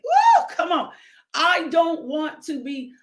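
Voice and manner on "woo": high whooping cry of praise; ecstatic woo. Real, not acted. Preacher whooping to the congregation, ecstatic high woo. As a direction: let out a joyful woo.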